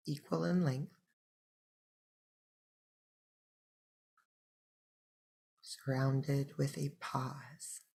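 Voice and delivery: soft meditation voice with long pauses; unhurried, hushed breathing-practice guidance; guided meditation. Acted. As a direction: guide listeners gently into the breath, leaving space between phrases.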